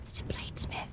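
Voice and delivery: breathless excited whisper. Real, not acted. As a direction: hush it out, thrilled. Repeats the find in a whisper.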